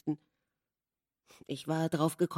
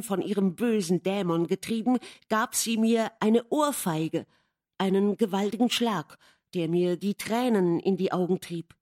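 usual speaking voice

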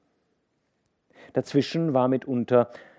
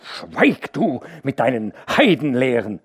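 stern, raised rebuke; angry rebuke; 4 semitones higher, 6 dB louder than usual. A narrator's reading. Hauke rebuking the worker. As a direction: cut him off hard. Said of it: "schrie ihn Hauke an".

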